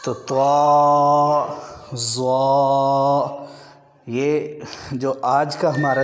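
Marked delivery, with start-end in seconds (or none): 0.0-3.4 طا and ضا said loud, full and drawn out; heavy letter recitation. Shown as a demonstration. Teacher drills طا and ضا for pupils. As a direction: recite طا then ضا heavily. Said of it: "طا سے آگے والا لفظ ہے ضا".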